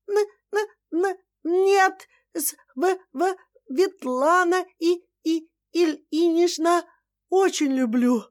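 выше, с запинками на каждом слове; испуганно заикаться; заикаясь. Acted. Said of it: "заикаясь". (испуганное заикание невестки)

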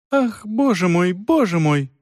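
high, helpless wail; helpless cry. A narrator's reading. A frightened young mother to the aunt, helplessly crying out. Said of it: "беспомощно воскликнула моя мать".

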